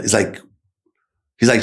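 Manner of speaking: ordinary talk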